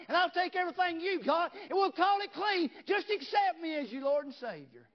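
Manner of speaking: loud, high, shouting revival preacher; full force preaching. Acted. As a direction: preach it out loud to the whole church.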